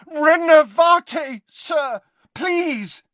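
high shouted spell falling to a plea; desperate cry. Acted. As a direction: shout the spell, then beg. Harry desperately retries reviving spell.

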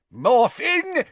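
father shouting his son's name; loud call. A narrator's reading. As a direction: bellow the name from the doorway. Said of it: "said a loud voice".

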